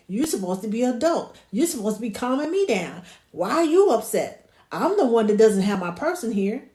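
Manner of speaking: loud, scolding mock outrage; exasperated, punchy protest; indignant baby. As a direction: voice a baby telling off the adult. Voices indignant baby scolding adult.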